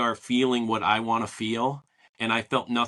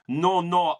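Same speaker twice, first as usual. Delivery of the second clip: put-on Russian accent; 6 semitones higher, 3 dB louder than usual. Russian fan protesting in the store, light Russian accent. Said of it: "in his Russian accent".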